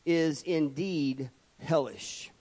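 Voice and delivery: ordinary talk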